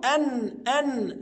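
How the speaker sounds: أن held slow with a ringing N; clear nun. Shown as a demonstration. Teacher isolating the nun for students, slow. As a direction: drag out the nun in أن. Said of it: "أجيب نون".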